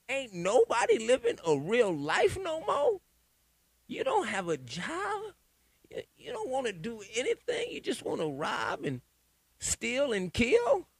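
high-pitched disbelieving rant; exasperated. Real, not acted. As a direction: go high, dragging out the complaint. Exasperated rant about hate music.

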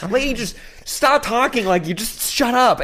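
very high, slow mock exasperation; exasperated. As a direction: act out being fed up, pitch way up. Retold fed-up reaction to yelling passenger.

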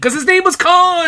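very high, loud comic yell; mock scream. Co-host mock-yells the running joke.

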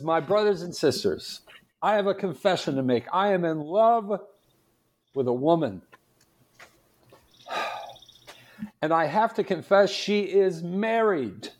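a preacher building up a big reveal. Retold or imitated. Priest repeats the bishop's joke.

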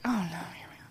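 breathy under-the-breath whisper of dread. Retold or imitated. Whispering to herself as a creep approaches, dreading. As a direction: mutter it to yourself, voiceless and dreading. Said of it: "And I was like".

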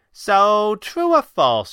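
high, loud, frowning demand; frowning challenge. A narrator's reading. Kit quizzes the Red Panda about their escaped lead, frowning. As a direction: put it to him sharply, with a frown. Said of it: "she said, frowning again".

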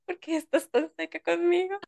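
high, whiny fake sob; whiny mock crying. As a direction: plead like a hurt friend, hammed-up. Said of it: "Y yo".

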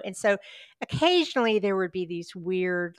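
ordinary talk, nothing marked

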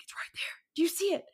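retold urgent whispering; hushed, breath-only insistence. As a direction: whisper it insistently, acting out the moment. Retold hushed urging during ghost tour.

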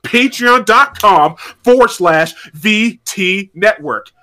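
slowed-down repeat, each word spelled out; slow and deliberate. Shown as a demonstration. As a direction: spell the web address out slowly. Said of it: "I will say it again for you".

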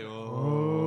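long, loud imitated crowd "ooh"; mock collective gasp, drawn out loud; crowd reaction. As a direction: mimic a whole audience going "oh". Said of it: "And then the crowd's like".

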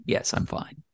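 breathy whisper, barely voiced. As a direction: whisper it as if hiding something. Retold reply to worried fans.

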